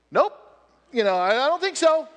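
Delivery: high, loud comic protest; scared little servant. Acted. Little servant up against the wall doubts Elisha, comically scared. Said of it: "little servant's up against the wall going".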